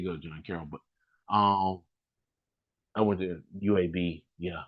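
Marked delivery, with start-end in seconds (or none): none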